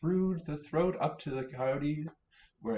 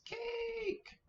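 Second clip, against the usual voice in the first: tiny squeaky bunny, much higher; little bunny voice; 5 dB quieter than usual. Acted. Minnie Rabbit to Mother Rabbit, squeaky high bunny voice. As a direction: chirp it like an eager baby rabbit. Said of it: "Minnie Rabbit says".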